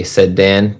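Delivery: plain reading voice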